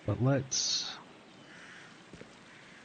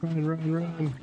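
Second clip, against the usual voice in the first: high frantic run run; being chased; 5 semitones higher than usual. Real, not acted. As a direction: urge yourself to flee in panic. Monster chasing player in game.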